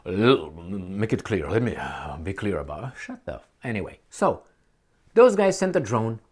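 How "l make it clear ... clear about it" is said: a hint of a measured president, dragging each word; Obama impression. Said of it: "As Obama said".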